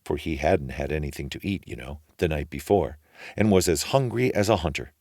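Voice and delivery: ordinary delivery, nothing marked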